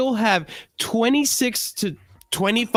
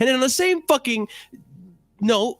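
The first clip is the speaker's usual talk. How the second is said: exasperated shout; 7 semitones higher than usual. Real, not acted. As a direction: let the frustration boil over into a shout. Heated rant at Chelsea fans.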